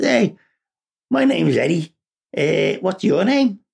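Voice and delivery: lightly voiced high Scouse kid; a touch of Liverpool schoolboy; Scouse accent. Scouse boy introducing himself to a new classmate, lightly accented. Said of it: "Scouseish accents".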